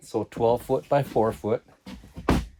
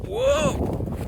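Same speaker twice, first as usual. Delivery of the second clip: high, startled whoa; sudden high-pitched alarm; startled cry. Real, not acted. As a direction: yelp as if losing your footing. Stumbles while hiking, then reassures viewers.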